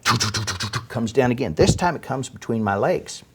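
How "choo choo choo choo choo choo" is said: rapid choo-choo jackhammer noise; imitated jackhammer. Storyteller imitating the jackhammer up above, staccato noise. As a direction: make a staccato drilling sound with the mouth. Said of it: "The next thing I hear is a jackhammer".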